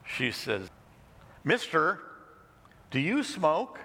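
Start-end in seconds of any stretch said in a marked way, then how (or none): 1.4-3.8 put-on lightly raised, childlike and curious; a hint of a child's piping question; little girl voice. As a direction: ask like a small bold girl.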